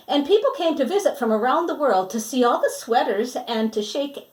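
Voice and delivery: lively storybook reading voice; reading to children. Acted. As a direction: read it brightly to the kids. Storybook narration resumes.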